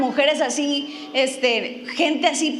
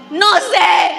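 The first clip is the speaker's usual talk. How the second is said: laughing shout; 3 semitones higher, 7 dB louder than usual. Retold or imitated. She shouts back to the pastor: loud laughing yell. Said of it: "toda le grito".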